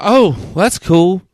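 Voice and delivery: slow, dopey mock voice of the odd guy; slow imitation of the guy. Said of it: "And he's like".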